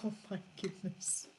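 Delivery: ordinary delivery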